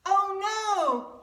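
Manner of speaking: high, loud alarmed "Oh no"; panicked cry. Acted. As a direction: cry out in fright like the king. Pharaoh panics at frogs.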